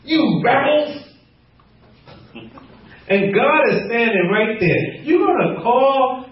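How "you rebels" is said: high-pitched mock scolding; raised, lightly indignant rebuke; angry Moses. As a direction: act out angry name-calling. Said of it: "'cause he was so angry".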